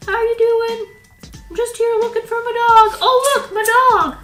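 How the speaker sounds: high, loud goofy toy man looking for his dog; a goofy toy character hailing a kid; silly man toy voice. Acted. Toy figure greets kid.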